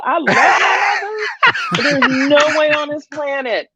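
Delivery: high, laughing half-shout; laughing refusal. Retold or imitated. Refusing to hand her son over, laughing. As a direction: laugh it off but mean no.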